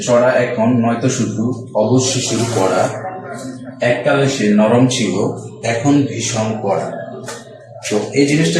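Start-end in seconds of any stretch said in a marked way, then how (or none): 0.0-7.0 slow, measured reading of a rhyme; rhythmic rhyme read aloud for the class; slow poem recitation. Shown as a demonstration. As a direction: recite the verse slowly, marking the beat. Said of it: "বলা হচ্ছে যে".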